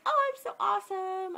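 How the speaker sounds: high, gushing mock self-praise; proud of myself. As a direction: play up being pleased with yourself. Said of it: "I was really proud of myself".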